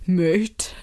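a light mock of a posh North Down accent, drawn out; imitating a comedian's posh North Down character. Comic character greeting everyone, lightly mocked posh North Down accent. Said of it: "he does his Mike McGoldrick character ... really posh accent".

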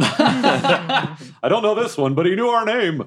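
high, slow character voice; hammed-up high-pitched sidekick; voiced devil minion. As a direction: answer in a high, drawn-out minion voice. Joins in voicing the devils.

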